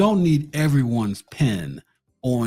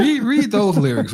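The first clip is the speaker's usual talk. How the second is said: excited; 9 semitones higher, 5 dB louder than usual. Real, not acted. Urging the cohost excitedly.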